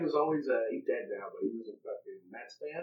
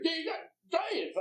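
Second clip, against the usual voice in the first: mimicked stranger; 4 dB louder than usual.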